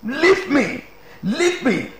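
high mock cry at no extra volume; imitated shouting. Preacher mimics mourner refusing the pastor's comfort, mock shouting. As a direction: plead high like the wailing mourner. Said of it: "She kept shouting".

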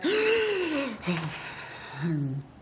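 big gasping inhale; deep gasp. Acted. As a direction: fill the lungs with a gasp. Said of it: "Beaver took a really deep breath".

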